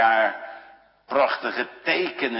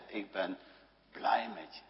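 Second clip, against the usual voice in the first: whispered; 4 semitones lower, 11 dB quieter than usual. Retold or imitated. Jesus speaks to us.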